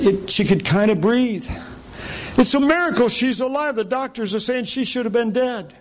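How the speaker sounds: high-pitched retelling of an overwhelmed husband; pitched well up, mock-tearful thanks. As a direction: imitate his tearful gratitude, without real sobs. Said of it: "And he starts crying, and he says".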